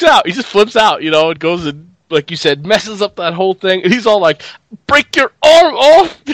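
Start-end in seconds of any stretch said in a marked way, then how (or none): 4.9-6.2 loud, high, drawn-out threat; hammed-up menace, stretched and loud; mock angry threat. Guest imitating captain ripping chicken, loud mock threat.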